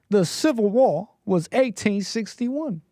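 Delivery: slow, lightly put-on Southern drawl; a touch of Southern storyteller. Streamer tells chat of war, light Southern accent. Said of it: "you sound like Steve Martin".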